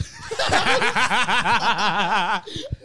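high, drawn-out peal of laughter; long loud laugh. Real, not acted. Storyteller reacting to the retold insult, long loud laughter.

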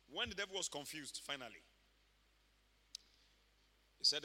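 normal talking voice

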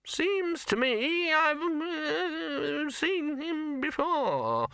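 put-on high, quavering old wizard; creaky doddering old man, high pitched. Poons voice.

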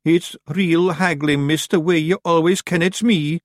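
high, slow Scots creature voice; drawn-out complaint of a caught little Feegle; Daft Wooly. A narrator's reading. Caught Feegle grumbling to the young witch, high slow Scots.

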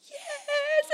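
very high, drawn-out squeal; imitated high-pitched delight; eager yes. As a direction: squeal it like a thrilled mum. Mother's eager answer retold.

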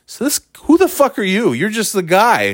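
high-pitched affronted retort; indignant. Retold or imitated. To the guy giving gift instructions, high indignant.

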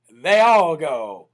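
slow, low, loud and weighty; dramatic drawn-out. Real, not acted. Host celebrates Alabama opening up, dramatic and drawn-out. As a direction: land it slowly and heavily. Dramatic slogan after good news.

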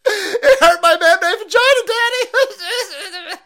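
high, loud fake sobbing whine; mock crybaby. Mocking the crying pilot, high fake sobbing whine. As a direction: ham up a blubbering crybaby.

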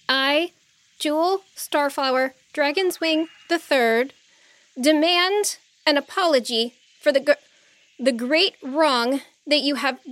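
nervous but proud, stumbling a little; young princess voice. Acted.

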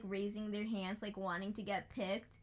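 ordinary delivery

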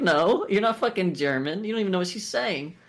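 mock indignant retort. Mock retort to sentimental soldiers.

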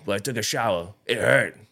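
mock deep-voiced thug rapper; Aiden. Scratched-up young man explains himself, mock thug voice. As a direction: imitate a caveman-like nineties rapper. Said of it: "He's got this deep voice".

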